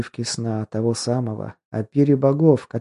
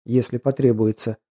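plain reading voice

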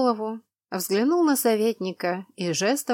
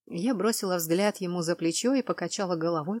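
ordinary delivery

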